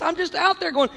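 ordinary delivery

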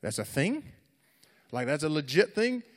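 loud, rising disbelief; mock astonishment, lifting at the end; incredulous surprise. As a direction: question it as if blindsided, hammed up. Retells being caught off guard.

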